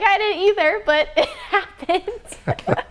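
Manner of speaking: laughing through the words, half in tears. Real, not acted. Laughing as she answers her grandpa, giggly and teary. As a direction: giggle it out, overwhelmed.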